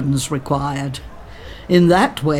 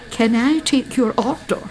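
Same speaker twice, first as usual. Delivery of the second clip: higher, polite Scots waitress; polite Scots accent; 8 semitones higher than usual. A narrator's reading. Waitress asking the couple for their order, polite Scots accent. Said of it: "Her accent was very polite Scots".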